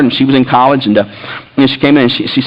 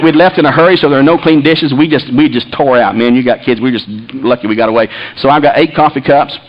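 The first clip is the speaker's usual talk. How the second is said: normal talking voice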